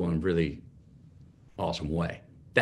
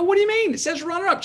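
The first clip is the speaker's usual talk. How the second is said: much higher and louder, baffled disbelief; incredulous; 5 dB louder, faster than usual. Retold or imitated. Son arguing with mom over the trophy, high incredulous. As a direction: protest in amazed confusion. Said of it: "I go".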